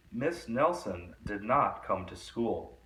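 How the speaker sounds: usual speaking voice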